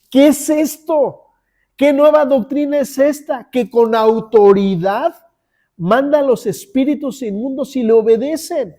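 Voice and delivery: amazed crowd. Acted. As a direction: marvel out loud at his authority. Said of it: "todos se asombraban". (asombro fuerte y agudo de la multitud)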